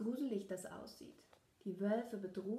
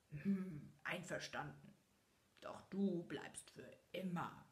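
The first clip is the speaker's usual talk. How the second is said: growling beast; 3 semitones lower, 4 dB quieter than usual. A narrator's reading. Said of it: "knurrte das Biest".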